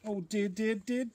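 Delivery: high, loud storybook lament; sighed dismay. A narrator's reading. Bear sneezes over the anthill, high wailed dismay. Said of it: "sighed Bear".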